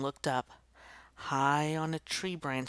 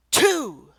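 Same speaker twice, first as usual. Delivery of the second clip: much higher, much louder shout; loud count; 13 semitones higher, 13 dB louder than usual. Acted. Children count down the kickoff, shouting louder. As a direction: bellow the count as it builds.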